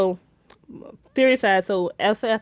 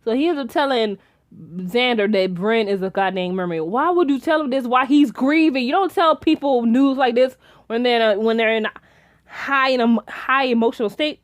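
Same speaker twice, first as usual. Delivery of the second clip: ordinary talk, nothing marked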